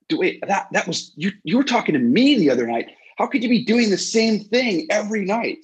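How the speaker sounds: you just realized you were fooled; incredulous disbelief. Retold or imitated. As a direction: fan catching Bruce repeating the same speech, incredulous. Realizing Bruce repeats his speech.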